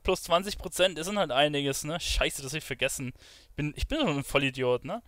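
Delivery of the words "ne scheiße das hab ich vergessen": usual speaking voice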